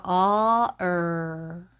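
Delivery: "어, 얼" vowel rolling into r; "어, 얼" ünlüden dil kıvrık r'ye geçiş; demo uh to er. Shown as a demonstration. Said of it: "이렇게".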